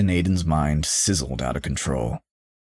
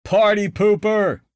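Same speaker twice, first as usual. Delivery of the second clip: high, slow teasing jab; Teddy mocking the cautious girlfriend; mocking rebuke; 5 dB louder, slower than usual. A narrator's reading. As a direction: sneer at the killjoy. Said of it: "rebuked Teddy".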